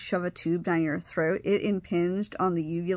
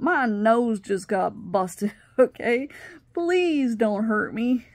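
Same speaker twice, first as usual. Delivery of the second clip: slow, emphatic plea; retold begging; 3 semitones higher, 4 dB louder, slower than usual. Stepparent stepping between two fighting kids, begging. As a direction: plead with them, stretching the key words. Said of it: "I'm begging them".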